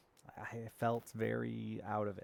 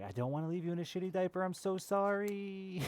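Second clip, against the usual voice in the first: much higher, rushed then stretched out sorry; high pleading apology; 10 semitones higher than usual. Retold or imitated. Parent apologizing to the baby, high and pleading. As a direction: squeak an apology to the screaming baby. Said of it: "And you're like".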